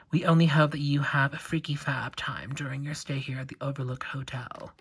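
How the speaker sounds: put-on British accent, breathed out as a spooky whisper; creepy twins whispering in a light British accent. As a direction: hush to a ghostly British whisper. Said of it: "making them British".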